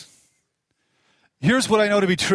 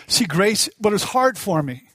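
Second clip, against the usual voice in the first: usual speaking voice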